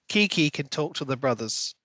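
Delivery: normal talking voice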